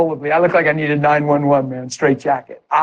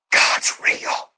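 breathless whisper; hushed amazement; awed whisper; 4 dB quieter than usual. Retold or imitated. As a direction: whisper it in awe. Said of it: "I dialed the phone".

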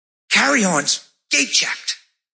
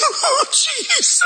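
high, slow joyful cry; ecstatic; much slower than usual. Real, not acted. Preacher crying out as the healed woman walks, ecstatic. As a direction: cry out to Jesus in joy. Watching her walk the steps.

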